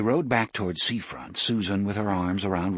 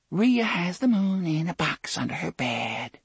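put-on lilting child's sing-song, higher; sing-song small child; 9 semitones higher than usual. A narrator's reading. Hypnotized girl lilting like a small child. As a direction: chant it like a little girl. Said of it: "lilted this in the sing-song voice of a small child".

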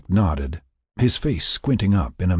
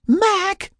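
very high, shrill squeal; horrified squeal; 4 dB louder than usual. A narrator's reading. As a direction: squeal the name in horror. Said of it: "Sarah squealed in horror".

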